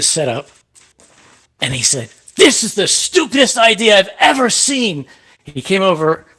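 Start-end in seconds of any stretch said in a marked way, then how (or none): 2.4-5.0 high-pitched, indignant retold outburst; high, angry imitation of a tirade; mad outburst. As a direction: act out an angry man tearing into an idea. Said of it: "He was mad".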